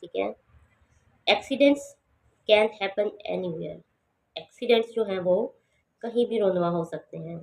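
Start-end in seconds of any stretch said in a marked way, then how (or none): 1.3-3.8 accidents said high, anywhere falling; slow teaching read with a falling finish; high start, falling end. Shown as a demonstration. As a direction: lift accidents, drop at anywhere. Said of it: "accidents को हमने बुलंद बोलना है".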